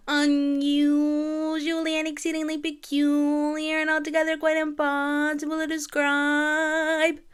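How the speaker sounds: high, slow, haughty lyric; drawn-out mocking Glinda; lofty, deliberate sneer; prissy Glinda. Quoting Glinda's lyric dramatically.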